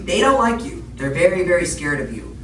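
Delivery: ordinary talk, nothing marked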